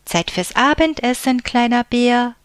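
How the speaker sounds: loud high call up the rocks. A narrator's reading. Big bear calling little bear to dinner, loud call. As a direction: call him in for supper. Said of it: "rief der große Bär".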